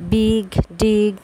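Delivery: বিগ, ডিগ slowly with a short 'i'; short clipped 'i' in বিগ and ডিগ; short i sound. Shown as a demonstration. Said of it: "আই থাকলে ই উচ্চারণ হয়". (বিগ ডিগ-এ ছোট ই ধ্বনি)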